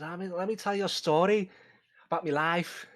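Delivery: put-on British accent. Player in character starting his life story, British accent. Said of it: "lay on the charm thick".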